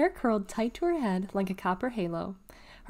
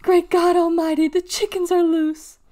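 high, loud panicked squeal; 9 semitones higher, 9 dB louder than usual. A narrator's reading. Clayton running in circles over escaped chickens, squealing. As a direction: squeal it out at full alarm.

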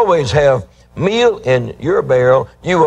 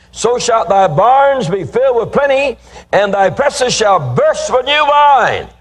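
soaring preaching; 8 semitones higher than usual. Acted. Preacher to congregation, reading Proverbs, soaring and triumphant. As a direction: proclaim it like a triumphant promise.

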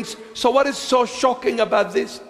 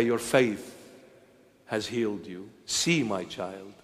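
much softer, hushed and tender; tender Jesus; 11 semitones lower, 9 dB quieter than usual. Acted. Jesus to the believer he heals, hushed and tender. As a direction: speak softly as Jesus healing the believer.

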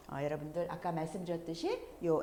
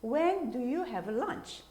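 very slow higher "When do you have lunch?"; slow, higher question demo; slow raised question; 6 semitones higher, much slower than usual. Shown as a demonstration. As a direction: ask it slowly. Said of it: "보통 우리가 많이 쓰죠".

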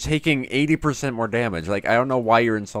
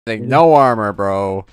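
loud indignant callout; loud gamer outburst; excited shout; 11 dB louder than usual. Real, not acted. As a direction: shout it in disbelief at the screen.

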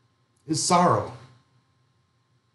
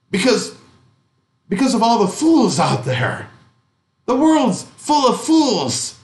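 put-on higher, louder grumbling old man, slowing at the end; bitter old curmudgeon; 8 semitones higher, 3 dB louder than usual. The bitter old man answering why he's so upset, mock grumpy. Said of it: "And he answers".

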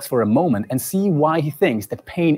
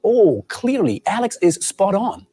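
slow, high, dripping sarcastic praise; sarcasm; 6 semitones higher, slower than usual. Acted. Host mocks Alex's point about elephants, slow heavy sarcasm. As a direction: drag out each word in mock agreement. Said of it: "I'm fine with using sarcasm".

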